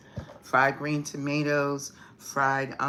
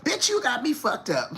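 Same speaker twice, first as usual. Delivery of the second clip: mock yell; 7 semitones higher than usual. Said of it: "came in screaming through the door".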